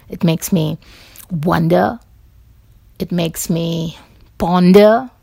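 ordinary delivery

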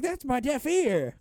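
high, drawn-out mock wail; film character yelp. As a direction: quote the movie line in a high, slow cry. Quoting film character being smacked.

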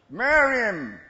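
high, drawn-out holler; loud, insistent urging; devil shouting. Acted. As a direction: belt it out as the tempter's command. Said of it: "the devil gonna shout".

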